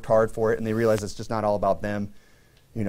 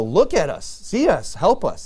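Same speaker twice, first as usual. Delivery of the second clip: high pleading calls; short appeals, pitch jumping up; crying out; 6 semitones higher, slower than usual. Retold or imitated. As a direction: imitate people begging to be noticed. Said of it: "That's what they're crying out here".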